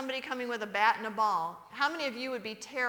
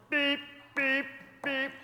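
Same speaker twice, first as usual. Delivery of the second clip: mimicked car horn beeps; 3 semitones higher, slower than usual. Church-bound husband honking for his bride, imitated horn. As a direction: beep like an impatient car horn.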